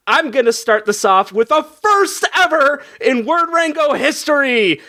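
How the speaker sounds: high, building show-host hype; hyped announcement. Real, not acted. Host announces a first to the players, hyped. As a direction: announce it like a big reveal.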